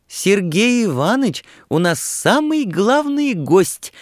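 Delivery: put-on very high, drawn-out naive girlish squeal. A narrator's reading. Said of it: "наивно взвизгнула Нюра".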